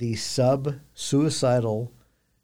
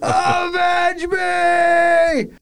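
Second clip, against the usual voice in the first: long, high, hammed-up dying yell; overacted death scene; 7 dB louder, much slower than usual. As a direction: stretch it into an endless cry. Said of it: "Dumbest doggone part".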